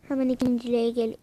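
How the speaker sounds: normal talking voice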